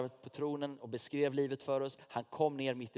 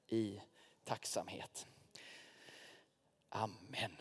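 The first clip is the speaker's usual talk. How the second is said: hushed amen; 4 semitones lower, 11 dB quieter, slower than usual. Acted. Closing prayerful amen.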